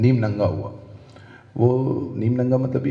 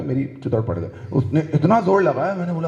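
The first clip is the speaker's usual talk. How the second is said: normal talking voice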